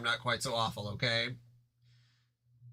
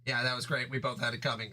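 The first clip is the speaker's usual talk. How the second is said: usual speaking voice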